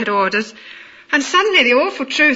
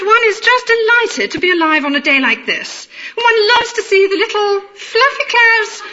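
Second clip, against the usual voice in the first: put-on upper-class accent; 10 semitones higher than usual. Rhapsodizing about the weather on the phone, mock upper-class accent. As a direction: lay on upper-class vowels, slowing into high rapture. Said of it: "I responded in kind".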